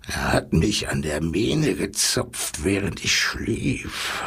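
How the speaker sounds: low, slow lion's rumble; grumbling lion. A narrator's reading. Lion complaining to the zookeeper, in a low grumble. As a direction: grumble slowly, deep down like a big lion. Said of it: "brummte er".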